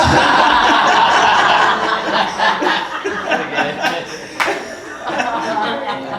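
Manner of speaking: long high laugh; real laughter. Real, not acted. Preacher laughing at the man stopping at nine thousand, long laugh.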